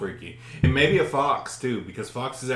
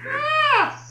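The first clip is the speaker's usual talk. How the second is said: piercing, very high mimicked shriek; imitated scream; 3 dB louder than usual. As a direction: mimic an animal's screaming answer. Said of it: "heard another scream".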